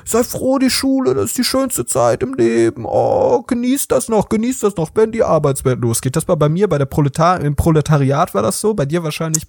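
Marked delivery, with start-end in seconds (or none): 0.0-6.1 mock wise elders. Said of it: "Dann sagen zum Beispiel Leute".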